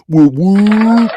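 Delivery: high, loud cheering whoop; whooping like at a party. Pretend. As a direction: hype up the chat. Cheering in chat.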